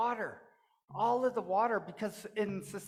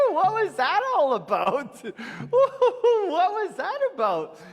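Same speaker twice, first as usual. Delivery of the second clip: much higher, loud laughing disbelief; bewildered laugh; 12 semitones higher, 10 dB louder than usual. Acted. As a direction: laugh it off in shock. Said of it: "And he went".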